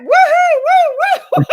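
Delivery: high, loud celebratory woohoo; excited whoop. Retold or imitated. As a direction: whoop like after a big win. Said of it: "I went".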